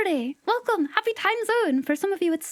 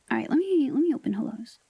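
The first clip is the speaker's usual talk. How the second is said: normal talking voice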